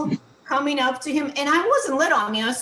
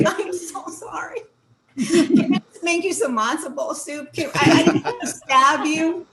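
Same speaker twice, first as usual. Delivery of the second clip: high, laughing, hammed-up apology voice; over-the-top sorry routine, giggling through it; 5 semitones higher, 4 dB louder than usual. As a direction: gush apologies like the guilty other half.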